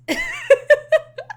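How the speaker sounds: high, loud burst of laughter; big delighted cackle. Real, not acted. Laughing at the scene.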